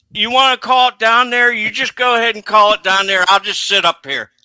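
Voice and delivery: loud, high sarcastic yell into the radio; mock him loudly for calling the race from below; sarcastic radio rant. Sarcastic retort over radio.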